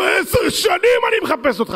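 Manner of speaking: high shouted woman's voice; woman's excited cry. Retold or imitated. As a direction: shout it in sudden recognition.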